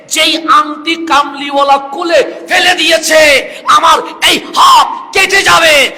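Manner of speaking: high, shouted sermon storytelling; full-force preaching. Acted. Preacher acts companions arguing over a dropped ring, full-force preaching. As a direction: preach the dialogue loud and impassioned, stretching the key phrases.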